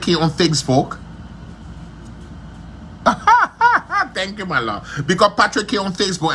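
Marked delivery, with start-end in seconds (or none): 2.1-4.1 high-pitched giggle. Real, not acted. Laughing at a viewer's comment during the live video, high giggle.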